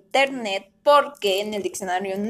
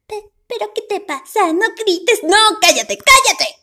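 high, louder put-on voice telling him to shut up; scolding other voice; 11 semitones higher, 6 dB louder, slower than usual. Neighbour voice shushing the screaming vlogger, loud and high.

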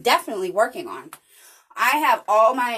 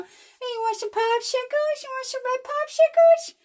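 much higher, put-on creepy stranger voice; 4 dB louder than usual. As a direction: lure kids like a cartoon creep offering candy. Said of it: "no creeper smile".

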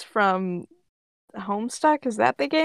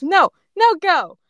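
put-on protesting toddler; 9 semitones higher, 5 dB louder than usual. A narrator's reading. Toddler Ash refuses to be taken.

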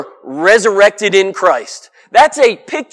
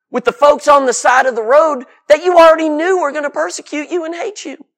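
normal talking voice

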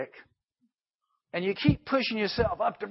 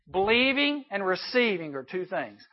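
ordinary talk

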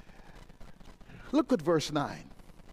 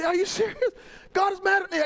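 incredulous protest; 10 semitones higher, faster than usual. Retold or imitated. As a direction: play a shocked believer. Believer protests to preacher.

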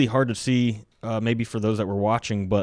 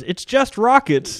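much higher, louder mock Ukrainian accent; squeaky, hammed-up Ukrainian impression; 13 semitones higher, 4 dB louder, slower than usual. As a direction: ham up the Ukrainian soldier's puzzled question. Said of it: "our Ukrainian counterpart that was attached to us".

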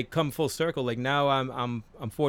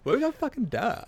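much higher, laughing mock voice of the clip; quoting TikTok clip; 12 semitones higher than usual. Friend quoting a funny TikTok clip back, high mock voice. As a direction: squeak out the silly line, cracking up. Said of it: "that stupid TikTok clip".

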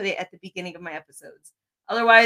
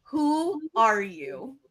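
dramatic question; much slower than usual. Acted. Host poses her big question.

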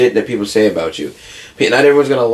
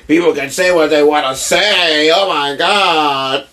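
high, stretched-out mock wailing; hammed-up horror, drawn out; mock hysteria; 6 semitones higher, slower than usual. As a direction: wail like a scandalized crowd. Mocking everyone's overreaction.

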